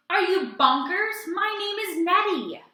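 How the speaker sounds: high, loud indignant howl; hammed-up monster howl. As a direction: wail it back, insulted. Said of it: "with a horrible howl".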